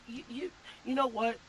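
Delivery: ordinary talk, nothing marked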